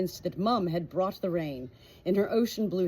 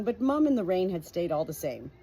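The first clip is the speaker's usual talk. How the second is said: plain reading voice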